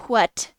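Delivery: "what" as a loud hwat; emphatic breathy wh. Shown as a demonstration. Imitating the insistent sub, "what" said hwat. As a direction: hit the h hard in "what", hwat. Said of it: "you say "what".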